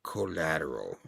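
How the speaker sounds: a low, soft murmur. Retold or imitated. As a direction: mutter it darkly under your breath. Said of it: "And the guy's like".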